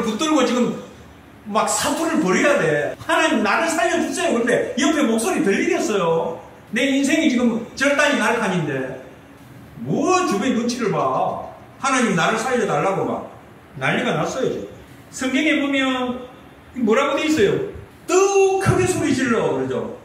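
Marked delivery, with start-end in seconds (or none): 3.0-4.3 high-pitched cry for rescue; acted desperate plea. Sinner begging God to save them, urgent plea. 18.1-19.5 emphatic retold cry. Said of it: "소리를 지르는데 더욱 크게 질렀다".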